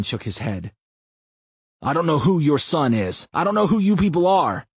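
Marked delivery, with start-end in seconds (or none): 1.8-4.7 desperate protest. A narrator's reading. Cornered man protests.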